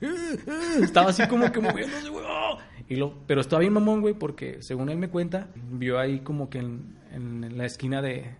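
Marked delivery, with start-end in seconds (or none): 0.0-2.6 high, strained mock struggling; straining grunts. Friend mimicking his buddy stuck in sleep paralysis, strained and high. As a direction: squeeze out strained cries, half laughing.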